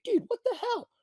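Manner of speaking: high mock outrage; mock complaint to ref. Fighter complaining to the ref, high mock outrage. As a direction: squeak it out like an offended fighter. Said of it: "look at the ref and say".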